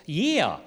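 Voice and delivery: "yeah" stretched into "YEE-uh"; two-syllable "yeah". Shown as a demonstration. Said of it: "the word yeah had two syllables".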